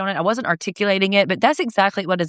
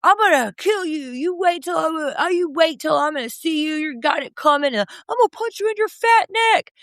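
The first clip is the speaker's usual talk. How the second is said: high, shouted mock threats; over-the-top imitation of an angry blowhard; Jax ranting threats; 9 semitones higher than usual. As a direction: rant threats in a strained, raised pitch. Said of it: "Jax is like".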